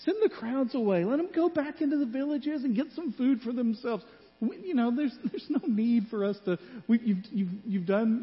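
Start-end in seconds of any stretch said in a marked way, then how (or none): 0.0-6.2 disciples' plea. Retold or imitated. Disciples voiced telling Jesus.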